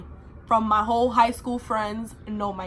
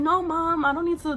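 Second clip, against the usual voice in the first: put-on teen protest; 7 semitones higher than usual. Teen denying to mother.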